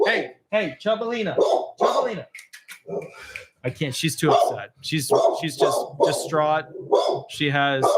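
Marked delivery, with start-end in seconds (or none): 0.1-2.3 higher sing-song pet name; dog pet name. Real, not acted. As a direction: call the pup lovingly by her nickname.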